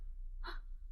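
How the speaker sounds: a quick unvoiced gasp of wonder; breathless little gasp of amazement; soft gasp. A narrator's reading. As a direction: catch your breath in awe. Wonder at being an astronaut.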